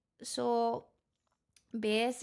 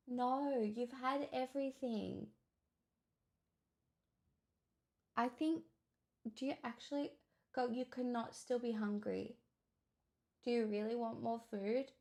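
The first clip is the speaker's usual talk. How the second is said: quiet pet scolding; 3 semitones higher, 7 dB quieter than usual. Real, not acted.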